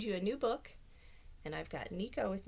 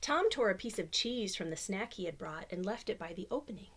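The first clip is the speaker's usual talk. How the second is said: plain reading voice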